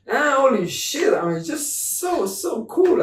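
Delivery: high, thrilled gush about how cool it sounds; excited rave. Real, not acted. Raving to a friend about the Texas cowboy accent, excited. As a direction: let the delight burst out.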